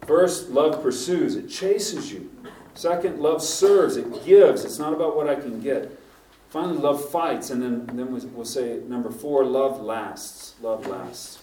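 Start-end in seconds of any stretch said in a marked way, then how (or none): 0.1-7.5 sermon points. Acted. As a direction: lay out each point with weight. Preaches the points.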